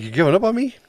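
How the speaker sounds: high, indignant squeak; a mock-hurt high-pitched protest. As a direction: pitch jumps way up in mock betrayal. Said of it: "I'm like".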